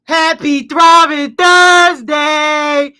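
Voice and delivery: slow stretched loud greeting; drawn-out hype call. Real, not acted. Streamer opening the show, stretched hype call. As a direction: stretch every word like an announcer.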